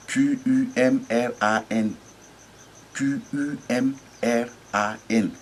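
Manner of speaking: Q U M R A N spelled slowly, letter by letter. Shown as a demonstration. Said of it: "je vais vous transcrire ça phonétiquement". (épeler Q U M R A N lettre par lettre, lentement)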